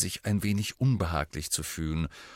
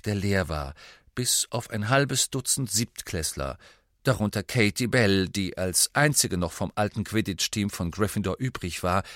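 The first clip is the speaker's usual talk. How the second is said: ordinary delivery, nothing marked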